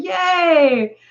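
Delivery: a bright, high cheer of delight; cheerful yay. Real, not acted. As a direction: cheer happily at the compliment. Cheering a viewer's comment.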